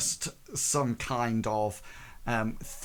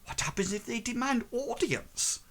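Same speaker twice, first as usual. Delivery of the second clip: pitched way up, a put-on voice; squeaky in-character ask; high character voice; 11 semitones higher than usual. Repeats question in character voice.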